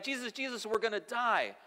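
high, mock-panicked cry for help; freaking out. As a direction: imitate panicked men waking someone up. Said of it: "they're freaking out".

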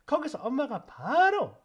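usual speaking voice

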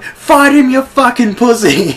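loud, high heckling yell; re-enacted schoolyard jeer at full volume; hurled abuse. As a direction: shout it from the crowd to egg him on. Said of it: "hurling abuse to this".

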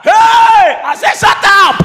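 loud, high outburst; retold shouting. Preacher silencing the shouting boy, retold shout. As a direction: yell over the child to silence him. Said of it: "Then you will shout".

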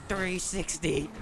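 stretched-out brag; slow hype call. Real, not acted. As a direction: announce the trick like a stunt caller. Hyping his own trick shot.

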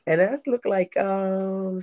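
normal talking voice

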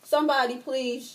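ordinary talk

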